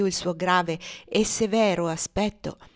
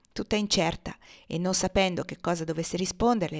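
ordinary delivery, nothing marked

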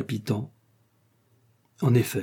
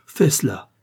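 emphatic urging, slightly raised voice; fervent exclamation; 3 semitones higher, 4 dB louder than usual. A narrator's reading. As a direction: exclaim it with fervour. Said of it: "s'écria-t-elle". (exclamation fervente)

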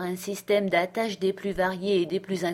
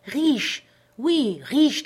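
much higher, loud insistence; vehement; 5 semitones higher, 4 dB louder, much slower than usual. A narrator's reading. Castaway insisting to doubtful Jim, vehement. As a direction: hammer the word riche home. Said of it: "il répéta son assertion avec véhémence".

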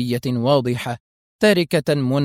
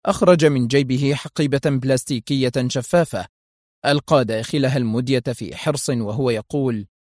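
plain reading voice

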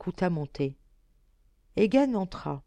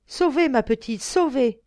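father to daughter, a louder, higher tearful cry of relief; weeping with joy; 6 semitones higher, 5 dB louder than usual. A narrator's reading. Father weeping over his saved daughter, loud tearful cry. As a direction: sob out the word sauvé, overwhelmed. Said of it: "Il pleurait à chaudes larmes".

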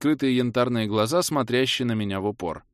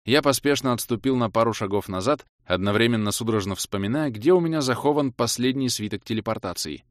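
ordinary delivery

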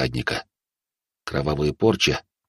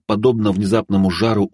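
plain reading voice

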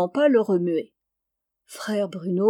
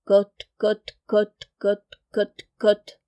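sifter clatter; much slower than usual. A narrator's reading. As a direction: tap it out like a sieve rattling. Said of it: "le blutoir fait".

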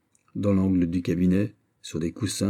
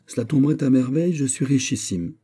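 fast, higher boastful prince; 6 semitones higher, 3 dB louder than usual. A narrator's reading. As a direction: show off his riches. (prince vantard, débit rapide)

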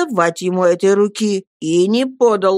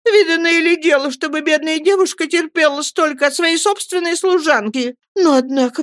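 tearful outburst; 11 semitones higher than usual. A narrator's reading. Mother rails at her servant, high and tearful. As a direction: plead hurt through tears. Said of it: "плача закричала матушка".

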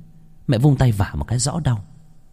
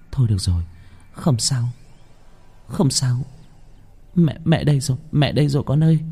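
soothing mother; 4 semitones higher than usual. A narrator's reading. Mother comforts crying child.

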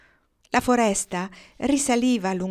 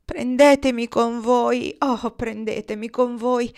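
slow, high pleading shout; big drawn-out plea, easing on the repeat; loud cry; 5 semitones higher, 5 dB louder, slower than usual. A narrator's reading. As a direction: cry out to be taken along. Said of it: "gridò la voce, così forte".

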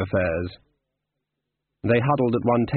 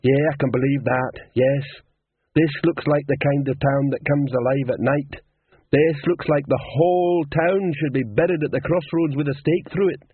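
higher-pitched character voice, heavily sarcastic; Cliff's sarcastic voice; 4 semitones higher than usual. Acted. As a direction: voice a scornful figure sizing up a dead town.

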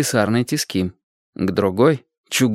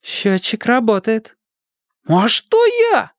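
mock innocent boy; 12 semitones higher, slower than usual. A narrator's reading. Boy caught out protests his innocence, high and cheeky. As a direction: play dumb like a caught kid.

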